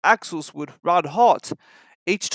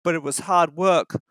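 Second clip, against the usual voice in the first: ordinary delivery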